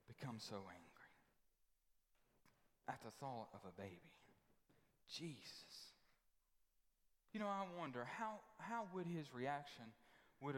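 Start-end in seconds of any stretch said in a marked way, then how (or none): 0.1-4.1 hushed whisper. Real, not acted. Preacher whispering to the church about Herod's fear. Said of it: "preacher drops to whisper". 5.1-5.9 soft reverent whisper. Real, not acted. Whispered name after question.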